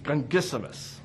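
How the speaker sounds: word said through clenched teeth, teeth shut. Shown as a demonstration. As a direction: say it without opening your teeth. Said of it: "you can say it through clenched teeth".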